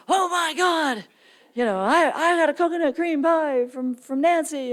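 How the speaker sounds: high, excited imitation of mom; delighted mom. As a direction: gush like a thrilled mother getting a gift. Mom voiced excitedly about pie.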